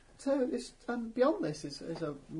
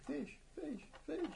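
high squawking gull call; seagull voice; 13 semitones higher, 9 dB quieter than usual. Pretend. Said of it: "you can speak gull".

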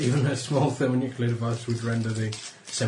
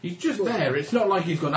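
ordinary talk, nothing marked

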